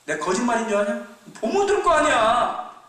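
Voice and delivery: high, loud, slowed challenge; older brother daring him to look, high and loud; challenging. Acted. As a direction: dare him to see for himself.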